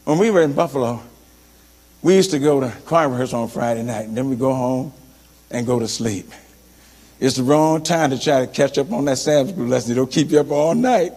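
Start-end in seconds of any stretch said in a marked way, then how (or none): none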